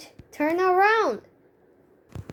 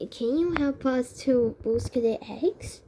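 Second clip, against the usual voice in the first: usual speaking voice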